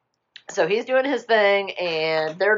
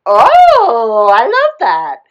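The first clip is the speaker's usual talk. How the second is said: loud, high delighted gush; overjoyed; 5 semitones higher, 12 dB louder, much slower than usual. Retold or imitated. Ray hears Naveen is wishing for Tiana, loud overjoyed gush. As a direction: burst out with hammed-up joy. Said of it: "he was so happy".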